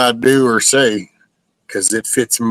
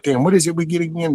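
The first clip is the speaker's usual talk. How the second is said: usual speaking voice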